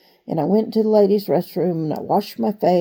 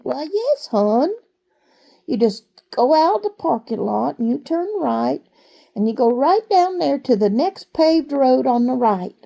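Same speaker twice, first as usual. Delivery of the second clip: sweet waitress; 6 semitones higher than usual. Retold or imitated. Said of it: "And she said".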